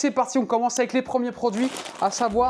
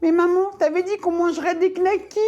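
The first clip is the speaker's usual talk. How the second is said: put-on whiny child voice; 9 semitones higher than usual. Kid begging mom for knackis.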